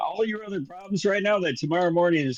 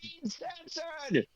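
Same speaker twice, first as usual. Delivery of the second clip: very high, soft mock scream; 12 semitones higher, 10 dB quieter than usual. Reporter blocked at the door claims censorship, mock screaming. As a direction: stretch out a thin, high cry of protest. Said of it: "He starts screaming".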